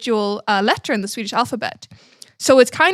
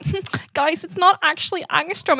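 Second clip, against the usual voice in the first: nerdy correcting; 7 semitones higher than usual. Retold or imitated. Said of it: "I was always the nerd".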